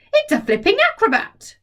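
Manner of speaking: put-on high, amazed old woman's cry; grandma shouting. Grandma shouting from the rooftop at the somersaulting hen. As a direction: marvel at the flipping hen. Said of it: "Grandma shouted from the rooftop".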